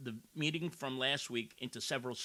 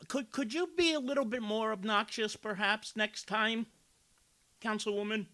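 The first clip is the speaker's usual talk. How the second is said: sarcastic; 9 semitones higher than usual. Real, not acted. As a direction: needle her with mock politeness. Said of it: "I'm yelling right now".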